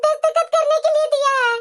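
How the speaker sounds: put-on a distressed young bride explaining the divorce papers; tearful daughter-in-law. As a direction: plead with mother-in-law, holding back sobs.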